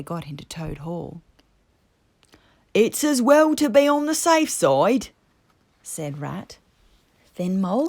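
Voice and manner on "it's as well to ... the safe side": higher, louder Rat voice; prudent Rat. A narrator's reading. Rat explaining the pistols to Badger, prudent. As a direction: sensible and a bit defensive.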